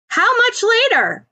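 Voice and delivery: calling up. Acted. Ochako calling up to Bakugo on the ledge, loud worried call.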